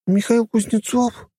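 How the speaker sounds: very high female voice, amazed; surprised exclamation. A narrator's reading. Prisoner recognising her unexpected rescuer, surprised. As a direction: draw out the name in astonished question. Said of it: "удивлённо воскликнула черноволосая Герда".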